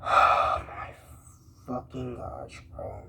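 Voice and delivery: breathless whisper of disbelief; real fed-up whisper; exasperated whisper. Real, not acted. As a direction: whisper it like you can't believe this. Exasperated at her excuse.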